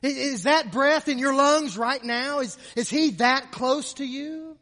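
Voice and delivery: loud, high, urgent questioning; impassioned preaching. Real, not acted. Preacher asking if He is that close, impassioned preaching.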